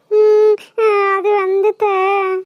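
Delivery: tearful, halting attempt to speak; crying. Upset girl to her aunt who asks why, crying. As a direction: stammer it out slowly through sobs. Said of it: "ஏம்மா அழுகுற?".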